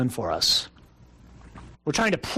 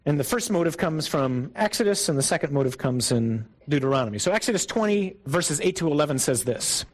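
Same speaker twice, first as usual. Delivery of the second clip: ordinary delivery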